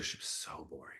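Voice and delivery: hushed whisper. Real, not acted. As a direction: whisper it like a secret. Confiding a harsh opinion.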